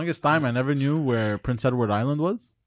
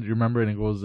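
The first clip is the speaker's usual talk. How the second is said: ordinary talk, nothing marked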